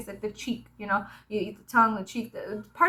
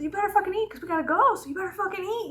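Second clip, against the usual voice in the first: imitating mom; 10 semitones higher, faster than usual. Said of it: "She's like".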